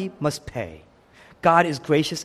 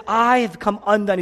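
high, raised lament; anguished cry; 5 semitones higher, 4 dB louder than usual. Acted. Jesus on the cross, crying out in anguish.

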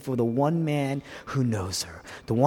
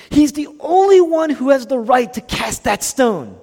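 loud, high, emphatic sermon delivery; full-force preaching; 10 semitones higher, 8 dB louder than usual. Real, not acted. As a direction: drive it home to the congregation at full voice.

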